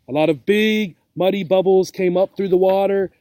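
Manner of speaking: ordinary delivery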